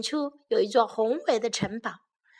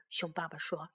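ordinary delivery

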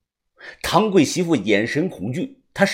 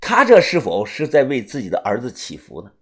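plain reading voice

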